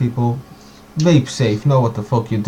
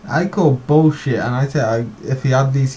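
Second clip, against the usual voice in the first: normal talking voice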